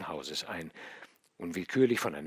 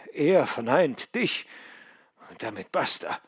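a wry laugh, then higher and harder on death; mocking himself as death closes in; bitter dying son; 3 semitones higher, 4 dB louder than usual. A narrator's reading. Dying nihilist musing on death alone, bitter and wry.

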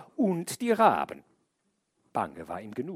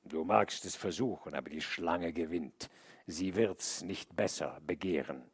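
low, scornful jeer; mocking; 5 semitones lower, 5 dB quieter than usual. A narrator's reading. The wolf and bear mock the man, low and scornful. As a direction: sneer at his hopes. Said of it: "Spottend sagte der Wolf und der Bär".

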